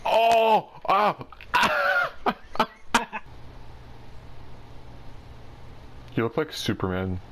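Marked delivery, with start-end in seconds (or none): 0.0-1.2 ohs climbing from normal to high; rising alarmed cries. Real, not acted. Gamer watching a mishap unfold, rising alarmed cries. Gamer reacts as crash builds. 1.5-5.2 high, wheezy burst of laughter. Real, not acted. Gamer cracking up after a crash, bursting laugh.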